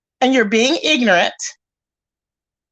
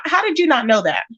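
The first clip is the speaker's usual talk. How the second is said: ordinary talk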